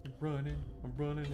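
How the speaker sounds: ordinary delivery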